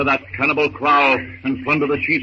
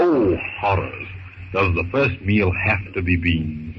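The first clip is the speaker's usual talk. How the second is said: mock horror; 8 semitones lower, slower than usual. Dismay at beans.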